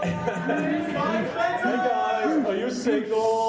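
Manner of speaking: high, slow, hammed-up creep voice; a slow, creepy mock leer on the word single; mock creepy guy. Guy at a con hitting on a shy fan, mock creepy. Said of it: "like Slender Man".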